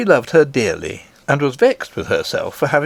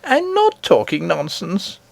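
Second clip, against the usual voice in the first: offended; 7 semitones higher than usual. Acted. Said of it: "rather offended".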